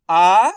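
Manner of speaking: आ held long and loud; drawn-out आ. Shown as a demonstration. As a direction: stretch आ out for the whole breath. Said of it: "आ का उच्चारण खींच के हो रहा है".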